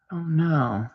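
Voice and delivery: normal talking voice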